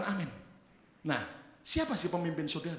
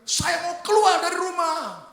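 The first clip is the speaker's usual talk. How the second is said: high, loud, drawn-out cry; acted yell; 12 semitones higher, 11 dB louder, slower than usual. Daughter yelling at her mother in a quarrel, acted shout. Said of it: "anak wanita teriak".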